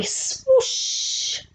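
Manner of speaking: very high breathy swoosh, no voice; whooshing sound effect. Acted. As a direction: make the hat zoom away. Hat flies up into sky.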